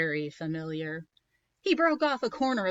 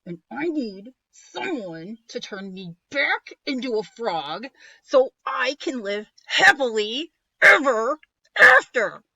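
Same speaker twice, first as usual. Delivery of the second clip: building to a loud cry; getting louder and exasperated; frustrated shout; 5 semitones higher, 11 dB louder than usual. Acted. Prince rising in frustration.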